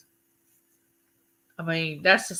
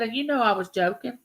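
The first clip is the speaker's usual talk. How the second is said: ordinary talk, nothing marked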